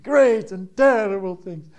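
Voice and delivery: hammed-up ominous storyteller; ominous teasing dad voice. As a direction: tease the kids with a grand spooky prophecy. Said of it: "in exactly that voice".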